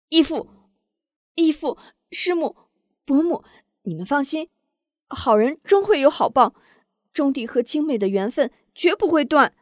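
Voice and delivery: high, loud heartfelt pleading; young woman rising up. A narrator's reading. Jia Xiaojiao rallies the grieving elders, high and loud. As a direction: stand up and reassure them all. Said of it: "不由挺身而起".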